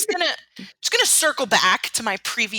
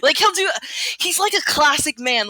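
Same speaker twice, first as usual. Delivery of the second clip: usual speaking voice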